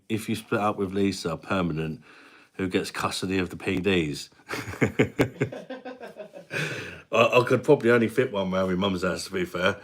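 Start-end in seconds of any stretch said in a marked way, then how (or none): 4.5-7.1 long, high-pitched chuckle; real laugh. Real, not acted. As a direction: keep chuckling at the question. Amused by viewer's custody question.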